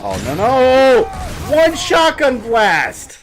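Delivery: high, slow, loud cry of dismay; dismayed shout. Real, not acted. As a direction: wail it as you get killed. Dying in game.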